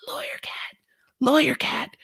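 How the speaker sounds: breathy whispered aside. Real, not acted. Host whispering "Lawyer Cat" as an aside. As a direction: whisper the name.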